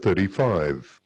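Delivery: ordinary talk, nothing marked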